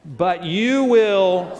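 drawn-out shout building to the last word; really loud, hit hard. Shown as a demonstration. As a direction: lead the crowd to belt it out. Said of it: "I want you all to really hit".

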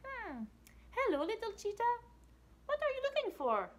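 high chirpy firefly greeting. Acted. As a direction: squeak it out like a tiny bright bug. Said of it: "comes by and says".

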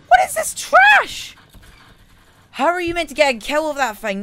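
loud, high-pitched rage; high gamer meltdown; exasperated yell. Real, not acted. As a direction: screech in frustration, then rant quickly. Raging at a weak gun.

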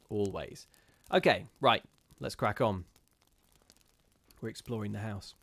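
plain reading voice

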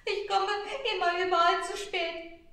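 put-on high and loud, a boy's tearful lament; sobbing. Shepherd boy telling the star he is late, tearfully. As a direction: wail that everyone has already gone. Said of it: "schluchzte der kleine Hirt".

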